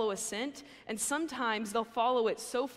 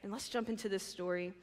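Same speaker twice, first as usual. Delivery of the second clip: ordinary talk, nothing marked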